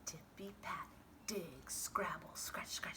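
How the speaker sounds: usual speaking voice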